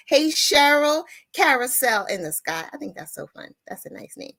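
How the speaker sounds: ordinary talk, nothing marked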